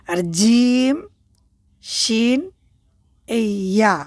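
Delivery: "জিম", "শিন", "ইয়া" all from one point in the mouth; same-place letters. Shown as a demonstration. Teacher demonstrates the letters "জিম", "শিন", "ইয়া" to students. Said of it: "এক জায়গা থেকে উচ্চারণ করতে হবে".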